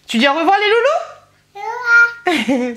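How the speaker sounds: high, slow sing-song goodbye. Real, not acted. Mother asking her baby to say goodbye in high baby talk.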